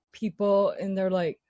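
usual speaking voice

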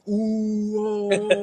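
high mock crowd gasp; crowd ooh. Imitating the parking-lot crowd reacting, surprised. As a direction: sing out a drawn-out ooh.

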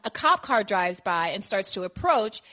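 usual speaking voice

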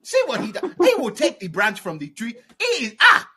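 high, loud, scolding mock African accent; generic African accent. Threatening to tell the father, mock African accent. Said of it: "generic African".

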